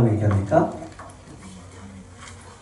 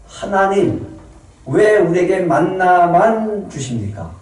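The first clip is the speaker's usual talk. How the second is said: slow, higher mock whine to God; greedy whining; 6 semitones higher, slower than usual. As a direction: whine for more than manna. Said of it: "탐욕은요".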